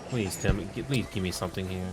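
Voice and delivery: ordinary talk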